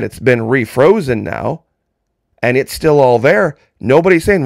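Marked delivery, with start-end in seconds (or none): none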